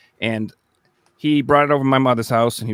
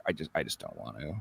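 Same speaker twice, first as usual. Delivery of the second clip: much lower and softer, a timid mumble; scared little refusal; 14 semitones lower, 14 dB quieter than usual. Retold or imitated. Player refusing to walk the dark hallway, small timid voice. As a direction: shrink back from the dark hallway.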